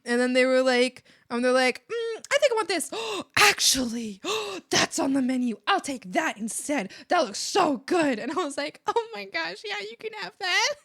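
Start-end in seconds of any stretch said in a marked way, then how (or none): 1.9-8.2 eager gasps and louder bursts; excited ordering. Retold or imitated. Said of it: "they were so excited about everything they were ordering". 8.9-10.7 high-pitched, melting agreement; delighted gushing. Retold or imitated. Answers excited customer in kind.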